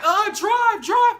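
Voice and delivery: high, loud panicked cry to the driver; panicked kid yelling drive; frantic plea. Retold or imitated. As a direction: beg her to floor it, near tears. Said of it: "I'm over there crying".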